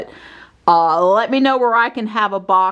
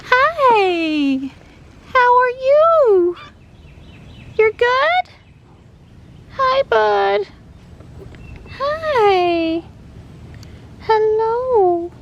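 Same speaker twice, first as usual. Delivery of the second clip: squeaky praising a dog; much slower than usual. Real, not acted. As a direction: coo at the pet in a squeaky voice. Greeting the dog on arrival.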